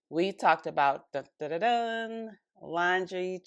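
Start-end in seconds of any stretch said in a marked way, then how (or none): 1.1-2.3 "dun, dun, dun" held high on the last note; playful dramatic reveal fanfare; mock drumroll sting. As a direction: sing out a suspense sting. Dramatic build-up before revealing topic.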